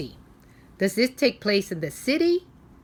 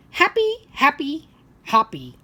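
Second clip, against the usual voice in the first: happy frog; 7 semitones higher, 7 dB louder than usual. Acted. Frog answering the little boy, loud and bouncy.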